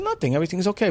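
jumps up high on nothing; high nervous denial. Retold or imitated. Father to the guest, a high nervous squeak. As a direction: squeak it out, hiding trouble.

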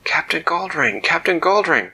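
high, loud call across the water. Acted. Dak calling up to the captain from the water, loud. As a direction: shout the captain's name up to the ship. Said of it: "He called out".

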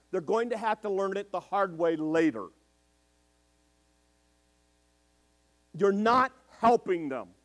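slow, emphatic preacher's warning; slow preaching. Real, not acted. Preacher warning parents they are crippling kids, slow and firm. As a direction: lay down the rule slowly, word by word.

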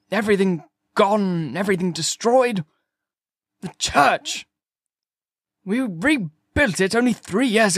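high, anguished lament; distraught curate. A narrator's reading. Curate grieves his destroyed church, high and anguished. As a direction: wail over everything that was lost.

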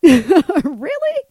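loud, high incredulous laugh on the word; laughing disbelief. Retold or imitated. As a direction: blurt it out in amused shock. Disbelief at the insult.

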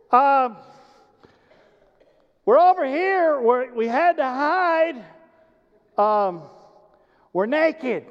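Adam stammering out from behind the tree; hesitant Adam. Acted. Adam answers God from hiding.

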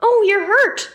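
high, loud motherly dismay; startled concern, pitched well up; concerned exclamation. A narrator's reading. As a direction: exclaim it like a worried mom. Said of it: "Mom explained".

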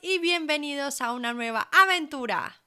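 mock-grand welcome with punched words; joking hype intro. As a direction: announce it big, as a joke. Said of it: "Bueno, bromas aparte".

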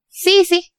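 eager yes from her; imitating the manager.

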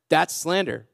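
ordinary talk, nothing marked

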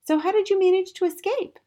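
put-on high-pitched child asking keenly; curious child. A narrator's reading. Matilda asking her teacher about escape, high and curious. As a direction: ask in a little girl's high voice. Matilda questions Miss Honey eagerly.

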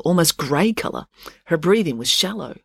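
usual speaking voice

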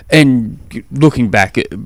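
normal talking voice

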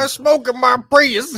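high, loud, slow Southern drawl; hammed-up Southern Prius driver; Southern accent. Co-host joins in as Prius driver, Southern accent. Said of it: "All three of us did that".

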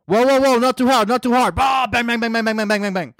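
high, panicked American police parody; hammed-up American cop overreacting, rapid gunfire noises; American officer alarm, easing off in volume. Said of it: "this is an American cop breath testing you".

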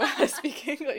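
words broken by a laugh; laughing self-correction. Retold or imitated. Woman mocks her own broken English slip, laughing. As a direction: protest to yourself, laughing.

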